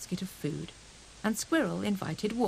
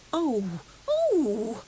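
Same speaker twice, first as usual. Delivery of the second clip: dismayed cry; 3 semitones higher, 5 dB louder than usual. A narrator's reading. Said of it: "cried Squirrel".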